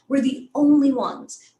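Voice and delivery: slow, strong stress on "only"; "only" stretched and punched; emphasis on ONLY. Shown as a demonstration. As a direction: make "only" stand out, drawn out. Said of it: "Make it stand out".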